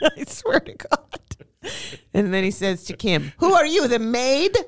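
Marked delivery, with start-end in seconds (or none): 3.4-4.6 higher, rude mock Italian guide; scornful guide in a rough Italian accent; bad Italian accent. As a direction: sneer it in a hammed-up Italian accent. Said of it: "it wasn't a great accent".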